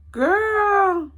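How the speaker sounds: put-on high, loud, stretched "Girl."; a friend's teasing scold to stop crying; drawn-out girl. Friend comforts crying teller.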